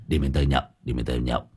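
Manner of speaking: put-on low, soft slurred drunk voice; drunken slur. A narrator's reading. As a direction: mumble it fading into sleep. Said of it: "giọng lè nhè".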